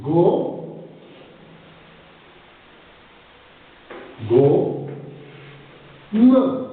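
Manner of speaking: "Go", "Me" as isolated syllables with long gaps; teacher's separated syllables, "Go"... "Me"; cut into syllables. Shown as a demonstration. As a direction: sound out "Go" and "Me" piece by piece. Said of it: "on va le découper".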